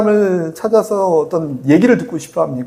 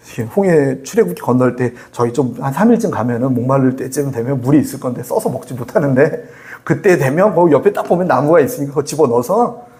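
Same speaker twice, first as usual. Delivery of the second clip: ordinary talk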